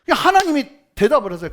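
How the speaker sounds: usual speaking voice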